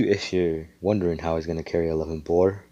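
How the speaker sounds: ordinary talk, nothing marked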